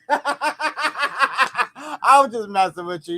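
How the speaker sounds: slow, laughing through the words. Real, not acted. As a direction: giggle while you speak. Laughing through teasing reply.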